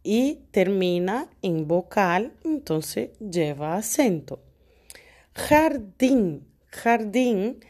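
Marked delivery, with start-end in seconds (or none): 5.3-7.6 jardín with a strong final dín; jar-DÍN, last syllable stressed; stress on DIN. Shown as a demonstration. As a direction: say "jardín" as a model, weight on DÍN. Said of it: "la sílaba más fuerte es el din".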